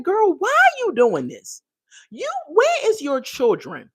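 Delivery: high-pitched, incredulous telling-off; exasperated scolding. Acted. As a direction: scold her from high up in your voice. Scolding a cast member in imagination.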